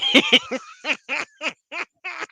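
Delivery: high-pitched real laughing fit; high giggles trailing on; helpless laughter. Real, not acted. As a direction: laugh till you cry. Crying with laughter.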